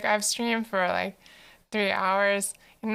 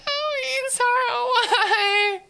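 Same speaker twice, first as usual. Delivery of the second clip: pained whimper; 3 dB louder, much slower than usual. Pretend. Crying out in mock pain.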